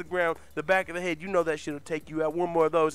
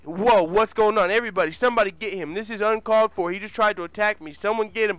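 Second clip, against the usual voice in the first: raised, louder panicked protest; acted alarm; 5 semitones higher, 7 dB louder than usual. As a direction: call out for help as if under attack. Acted plea while being attacked.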